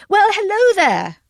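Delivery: bright, high and loud hello; cheery greeting. A narrator's reading. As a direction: greet him cheerily from afar. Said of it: "I said cheerily".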